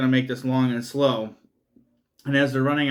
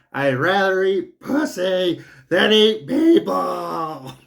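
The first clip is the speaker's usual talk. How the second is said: louder, higher, very slow hammed-up yell; yelled line; 6 semitones higher, 5 dB louder, much slower than usual. Savannah yelling at the cannibal dad, hammed-up slow yell. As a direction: yell the line out, dragging each word. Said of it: "She yells the line".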